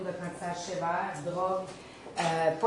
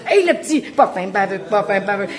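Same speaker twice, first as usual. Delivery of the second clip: put-on loud, excited child shouting out the answer; eager kid answer; 11 dB louder than usual. Kid proudly shouting the answer in class, loud.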